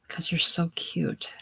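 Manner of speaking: breathy teasing whisper; whispered flirt. Acted. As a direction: lean in and whisper it sweetly.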